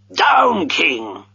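high shout on Down, then dropping; dog command. A narrator's reading.

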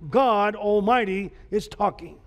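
ordinary talk

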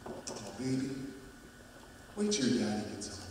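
put-on mother quietly deferring to the boy's father; hushed mom. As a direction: hush it, putting the question off. Mother deflecting son's question.

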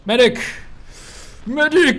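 yelling for a medic; man down shout. Real, not acted. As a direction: shout for help, loud. Calling out for medic.

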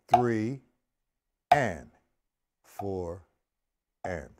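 spaced, one word per beat; counting out beats. Shown as a demonstration. Drum teacher counting out line three, evenly spaced beat count. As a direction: count slowly in time with the strokes.

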